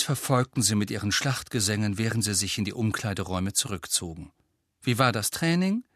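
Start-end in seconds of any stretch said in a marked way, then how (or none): 4.8-5.8 coolly polite question; reserved, rising question. A narrator's reading. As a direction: ask it with a touch of frost. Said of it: "in recht kühlem Ton".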